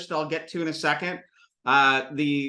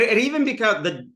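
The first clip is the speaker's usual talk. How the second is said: normal talking voice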